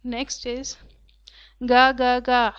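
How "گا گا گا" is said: loud imitated gull call, ga ga ga; gull cry. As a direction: squawk it like a hungry young gull. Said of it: "he cried". (بھوکے بچے بگلے کی زور دار آواز)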